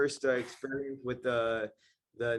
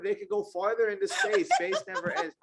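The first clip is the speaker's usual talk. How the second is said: high, giggling through the words; laughing while riffing on the sequels; 9 semitones higher than usual. Real, not acted. Friend laughing to co-hosts about endless franchise sequels, giggling high.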